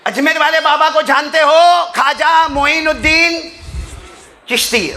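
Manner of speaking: high, loud impassioned preaching; preaching at full force. Acted. Preacher telling the congregation the story, in loud impassioned preaching. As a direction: call it out to the crowd with fervour.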